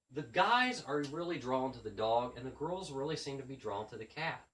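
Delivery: ordinary delivery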